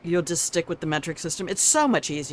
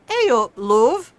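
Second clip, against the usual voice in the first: a louder, higher British "hey, love"; British greeting; 4 semitones higher, 7 dB louder than usual. Retold or imitated. Mocking how English neighbours greet, British accent. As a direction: toss off a cheery English greeting. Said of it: "they say".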